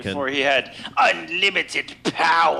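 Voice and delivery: Emperor voice. Retold or imitated. Quoting the Emperor line.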